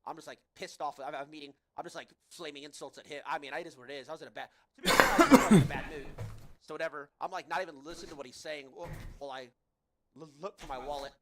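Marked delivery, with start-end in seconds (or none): 4.8-6.1 laughing. Real, not acted. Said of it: "laughing at himself".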